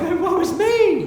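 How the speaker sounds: high, hammed-up cry of woe; mock wail. Fly wails to the spider, mock crying. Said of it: "the fly, he cried".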